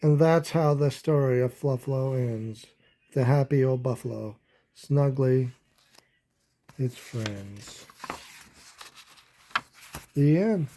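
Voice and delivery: lively storybook read-aloud; animated children's story narration. Acted. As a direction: read it like a bedtime picture book.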